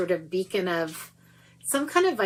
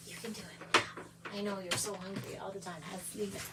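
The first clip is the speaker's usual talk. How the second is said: much softer, coaxing a pet; 10 dB quieter than usual. Real, not acted. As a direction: coax the hungry dog gently. Coaxing the dog softly.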